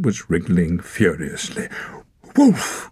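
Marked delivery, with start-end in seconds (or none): 2.4-2.9 joyful high cry; cried out. A narrator's reading. Torak crying out at seeing Wolf, cried. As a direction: cry out his name. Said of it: "cried Torak".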